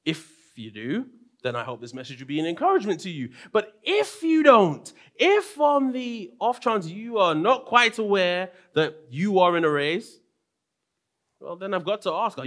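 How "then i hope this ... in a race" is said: ordinary delivery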